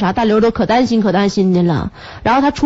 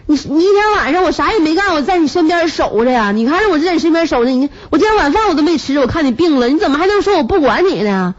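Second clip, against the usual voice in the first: higher-pitched, imitated exasperated pleading; flustered protest; 7 semitones higher than usual. Anxious husband defending himself to sick wife, flustered mock protest. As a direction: plead your case as the wronged husband.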